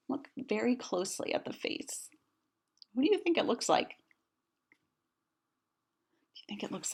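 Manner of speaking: hushed aside; conspiratorial aside. A narrator's reading. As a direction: whisper the joke to the child. Narrator aside to child listener.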